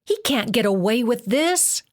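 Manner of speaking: 高めに強く叫ぶ; 憤りを込めて声を上げる; cry out. A narrator's reading. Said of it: "Artemis cried".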